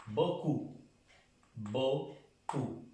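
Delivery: "beaucoup" said "boku", the EAU as O; French beaucoup. Shown as a demonstration. Teacher showing the class how "beaucoup" sounds. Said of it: "La E A U suena O".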